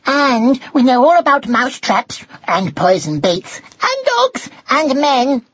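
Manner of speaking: high, squeaky know-it-all recital; cheeky young mouse. A narrator's reading. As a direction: reel off the dangers in a high, knowing voice. Young mouse answers cat.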